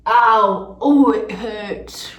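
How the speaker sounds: loud, drawn-out ouch; pained yelp. Real, not acted. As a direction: cry out at the sting, slowly. Hurts while cleaning face.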